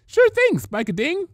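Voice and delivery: very high, loud squeaky chirp; chirpy. Acted. Pinkie Pie greeting Spike, very high and chirpy. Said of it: "Pinkie chirped".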